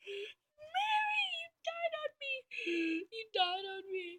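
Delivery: mock wail. A troop mourns Mary in a hammed-up high wail. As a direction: wail over a fallen friend. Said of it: "she's like".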